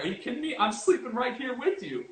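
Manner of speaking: a voiced Jesus, amazed at their fear; incredulous Jesus. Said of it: "Jesus is like".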